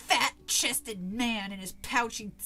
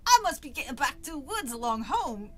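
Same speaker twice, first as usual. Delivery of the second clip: loud Cockney farewell; British accent; 6 dB louder than usual. A narrator's reading. Village boy excuses himself to go home, British accent. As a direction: take your leave in put-on Cockney. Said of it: "very proud of that British accent".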